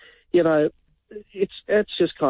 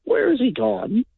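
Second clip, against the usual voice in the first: puzzled listener; 10 semitones higher, slower than usual. Retold or imitated. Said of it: "then I notice you'd go".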